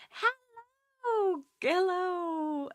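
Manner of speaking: very high, drawn-out greeting; sing-song hello. Real, not acted. Drawn-out happy greeting to viewer.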